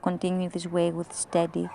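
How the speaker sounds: measured meditation guidance with long pauses; guided meditation. Acted. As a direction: lead them inward in even, deliberate phrases. Leads a recorded guided meditation.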